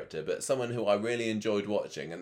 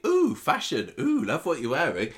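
fashion gush; 6 semitones higher, 5 dB louder than usual. Retold or imitated. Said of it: "You say".

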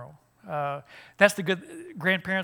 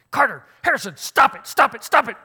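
imitating a mom shouting at her boys, much higher and louder; mother yelling at kids; 8 semitones higher, 11 dB louder than usual. Daughter on the phone yells at her sons to stop, imitated shout. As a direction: yell it across the house at two rowdy boys. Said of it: "stops the conversation and says".

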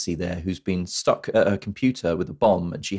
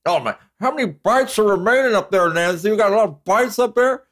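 mock cop calling up; 11 semitones higher than usual. Podcaster mocking the cop shouting up from under the desk, high silly voice.

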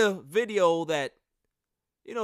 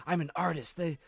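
a lightly voiced, softer aloof star; mock aloof artist; 7 dB quieter than usual. Host imitates Kyrie brushing off teammates, softer mock artist. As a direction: play a precious artist asking for space, softer.

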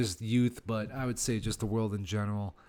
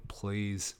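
ordinary talk, nothing marked

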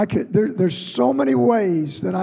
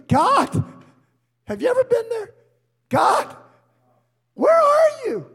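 desperate cry to God. Retold or imitated. Preacher crying out to God for help.

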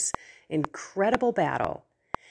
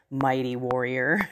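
lower, grand address to a hero; angel of the Lord; 6 semitones lower than usual. Acted. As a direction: proclaim it as a heavenly messenger, pitched down. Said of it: "an angel of the Lord says".

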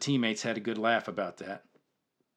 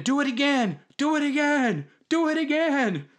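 much higher, excited chant from the sideline; screaming; 12 semitones higher than usual. Retold or imitated. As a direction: yell it over the crowd, again and again. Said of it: "I started screaming".

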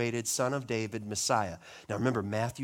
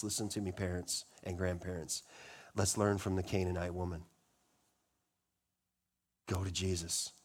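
earnest appeal; 7 semitones lower, 7 dB quieter than usual. Real, not acted. Intimate appeal to parents.

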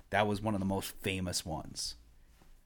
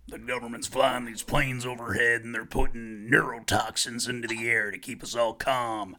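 Alex Jones; 2 semitones higher, 3 dB louder than usual. Acted. Co-host doing a conspiracy host's chemtrail warning, ranting impression. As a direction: punch each alarm like a conspiracy broadcaster. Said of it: "that was a spot on impression".

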